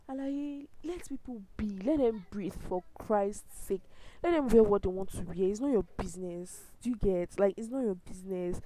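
loud, heated telling-off that builds to a shout; angry rant. Real, not acted. Vlogger scolding people who body shame, loud and furious. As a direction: scold them hard, full of real anger.

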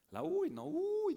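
sudden high squeal of mock fear; yelp, as if the very thought terrifies you; mock fright. Brothers acting out horror at waking mom, high yelp. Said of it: "nos decían".

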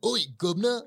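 high, hammed-up British accent; a cartoon British gent in a put-on British accent; mock British accent. Podcast guest mocking a British veteran comic, hammed-up British accent. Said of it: "he's British".

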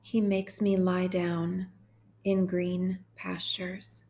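slow, measured psalm reading; guided meditation. Real, not acted. Host guiding sad listeners through Psalm twenty-three, slow meditation. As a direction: read each phrase slowly, leaving room to repeat it.

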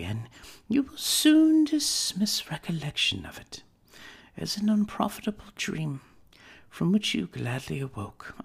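put-on a young woman letting go of her fiancé; Belle, sorrowful. Belle parting from young Scrooge.

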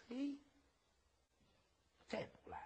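breathy sly aside, almost unvoiced; a greedy whispered aside. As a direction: mutter it to yourself like a schemer. Villain sizing up the girl, muttering.

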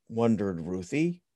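usual speaking voice

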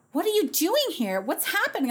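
high-pitched startled questioning; imitated bewilderment. Costume assistant seeing her arrive, imitated bewilderment. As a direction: play a baffled helper caught off guard.

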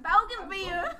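loud, high Southern-accented BOUGAINVILLEA. Shown as a demonstration. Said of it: "say it with my southern accent".

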